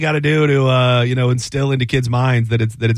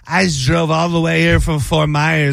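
a light imitation of a tipsy older woman, a little higher; drunk lady voice; 3 semitones higher than usual. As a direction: slur it slightly like a drunk stranger at the checkout. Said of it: "That's a good drunk one".

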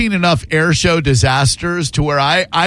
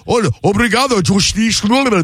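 mock Portuguese accent; 7 semitones higher than usual. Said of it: "the Spanish accent that you used".

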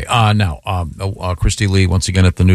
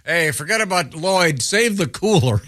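mock buddy voice; 8 semitones higher, 4 dB quieter than usual. Buddies voiced in a joke.

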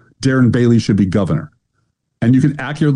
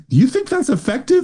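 mocking high voice; 13 semitones higher than usual.

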